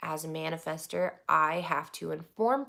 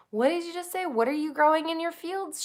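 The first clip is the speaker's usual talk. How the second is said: high, incredulous questioning; shocked mom; 11 semitones higher than usual. Retold or imitated. Mom retelling her shocked question to her daughter, high. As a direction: ask in disbelief. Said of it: "I was like".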